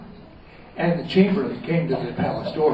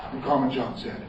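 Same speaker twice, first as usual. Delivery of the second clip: plain reading voice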